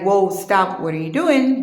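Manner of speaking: loud, startled cry to stop him; alarmed shout. Retold or imitated. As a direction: yell it to shove him off. Pushing him away in the water.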